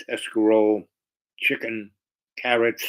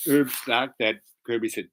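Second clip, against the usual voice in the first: usual speaking voice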